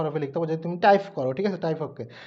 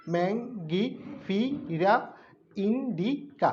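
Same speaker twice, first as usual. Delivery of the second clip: ম্যাঙ্গিফিরা drawn out very slowly, each syllable apart, then indica; much slower than usual. Shown as a demonstration. Teacher showing students how to split a Latin name, very slow. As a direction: stretch out every syllable for the class. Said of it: "ভেঙে ভেঙে বললে সুবিধা হয় যে উচ্চারণ করতে".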